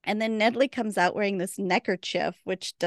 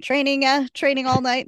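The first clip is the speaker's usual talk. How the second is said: raised, louder flustered correction; flustered stammer; 7 semitones higher, 8 dB louder than usual. Retold or imitated. Waverly caught out by Wynonna, flustered and louder. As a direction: caught out and scrambling for an excuse.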